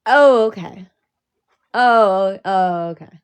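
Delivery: loud sarcastic oh okay; hammed-up dawning oh okay; sarcastic retold reply; mock loud oh okay. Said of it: "And I'm like".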